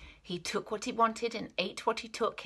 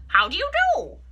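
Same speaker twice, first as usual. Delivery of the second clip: much higher, cheerful duck; polite duck; 8 dB louder, faster than usual. Acted. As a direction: greet the rat brightly.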